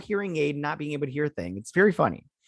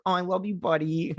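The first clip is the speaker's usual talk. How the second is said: high, cooing affection; retold tender hug; 6 semitones higher than usual. Father hugging his son during the show, retold tenderness. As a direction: coo it to your kid mid-hug, pitched up. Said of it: "like, hugging him".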